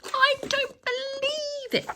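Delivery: a teacher cries out in shock; shouted disbelief. A narrator's reading. Teacher shouts in disbelief at the slime bird, high and slow. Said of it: "shouted one".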